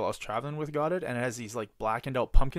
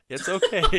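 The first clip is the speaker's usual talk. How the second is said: laughing; 5 semitones higher, 9 dB louder than usual. Real, not acted. Laughing at mom's reaction.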